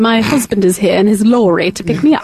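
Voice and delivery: posh British lady taking her leave; put-on British accent. Mom excuses herself to the doubting host, British accent. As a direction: make polite British excuses to go. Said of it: "do the British accent".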